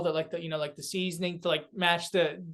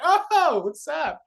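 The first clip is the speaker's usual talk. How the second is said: surprised greeting; 10 semitones higher, 5 dB louder, much slower than usual. Retold or imitated. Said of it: "they're like".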